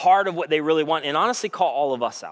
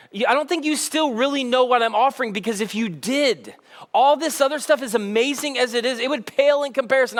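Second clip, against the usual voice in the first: higher, pressing voice of Jesus pleading with the crowd; urgent plea; 7 semitones higher than usual. Acted. Preacher voicing Jesus' urgent plea.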